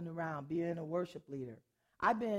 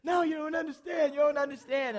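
higher, louder repeated protest; insistent shout; 8 semitones higher, 6 dB louder than usual. Retold or imitated. Roommate trying to confess her prejudice, urgent shout. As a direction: press it twice, urgently. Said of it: "So she goes".

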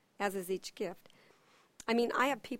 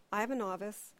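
ordinary delivery

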